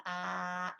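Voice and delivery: low open-mouthed "uh"; demonstrated jaw-stretch noise. Shown as a demonstration. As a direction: release the jaw on a long low "uh". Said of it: "you can make a noise as well".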